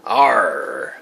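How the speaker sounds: low, drawn-out pirate arr; playful pirate growl for a child. Pretend. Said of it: "what does a pirate say?".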